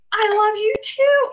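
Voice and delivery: high, loud outpouring of love; gushing delight. Real, not acted. Host answering a viewer's love message, gushing. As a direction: gush it after a gasp.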